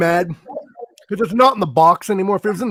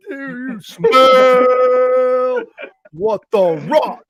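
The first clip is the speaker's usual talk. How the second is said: slow booming wrestler catchphrase; drawn-out hype wrestler voice; The Rock catchphrase; 5 semitones higher, 4 dB louder, much slower than usual. Pretend. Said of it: "Dwayne The Rock".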